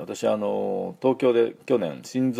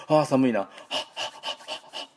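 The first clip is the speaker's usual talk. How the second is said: acted shivery-day complaint, then voiceless puffs; warming cold hands; 5 semitones higher than usual. Shown as a demonstration. Teacher acting out the H sound, warming cold hands. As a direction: huff repeated breaths as if onto cold hands. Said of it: "寒い時に手をこすり合わせて".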